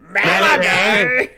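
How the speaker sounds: loud, slow sing-song call; creepy calling shout. Pretend. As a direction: call out the name eerily. Creepy calling name game.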